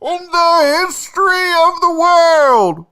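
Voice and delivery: high, loud drawn-out announcer shout; big theatrical high-pitched proclamation; hype announcer. Acted. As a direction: belt out a grand show intro, stretching the words. Show's signature hype introduction.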